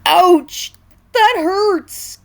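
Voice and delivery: high, loud pained whine; complaining king. A narrator's reading. Bruised King Pig complaining in pain. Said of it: "complained a bump and bruised King Pig".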